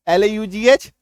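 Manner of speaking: ordinary talk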